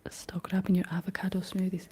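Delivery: normal talking voice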